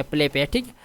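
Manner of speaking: ordinary talk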